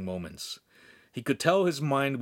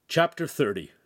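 usual speaking voice

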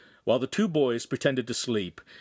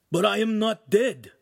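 high, loud insistence; frantic youth, pitched up; alarmed protest; 11 semitones higher, 5 dB louder than usual. Acted. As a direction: protest desperately, falling at the end. Ugolino protests he lives.

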